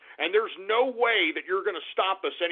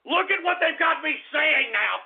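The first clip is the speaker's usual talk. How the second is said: usual speaking voice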